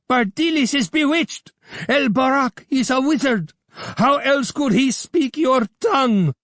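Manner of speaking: high, loud screech of a hateful man; vulture-like Abdullah screeching; screeching accuser. A narrator's reading. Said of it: "screamed Abdullah".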